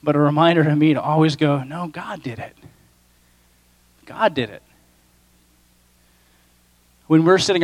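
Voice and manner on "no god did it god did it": much softer, almost under the breath; hushed humble. Acted. A believer reminds himself who did it, hushed. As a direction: say it quietly, as a private admission.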